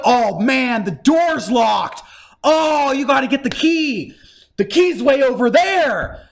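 big exaggerated dismay at each new snag; hammed-up exasperated quest prompts; mock game guide. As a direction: parody a game piling on chores. Mocking endless game obstacles.

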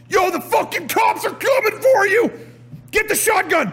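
high-pitched mock battle yell; frantic game shouting. Player acting out cops coming in the game, frantic high shouting.

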